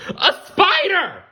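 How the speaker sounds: very high, loud startled shriek; frightened yell. Acted. Hermie seeing Webster behind him, shrieking. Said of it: "Hermie yelled".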